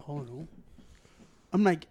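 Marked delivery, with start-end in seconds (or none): 0.1-0.5 low, soft mumbled excuse; a lightly voiced mumble, lower and much softer; meek Ciro voice. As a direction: play the sheepish kid caught out. Imitating Ciro's meek excuse.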